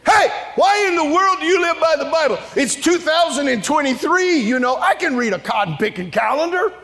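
high, strident scolding; mock indignation. The world questioning Bible believers, high mock-indignant shout. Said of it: "they'll look at us and go".

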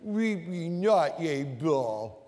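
low, soft, slow pout; pouty whine. Shown as a demonstration. Preacher showing the pouty version, low and slow. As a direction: push the lips out and whine. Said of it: "get the pooch mouth there".

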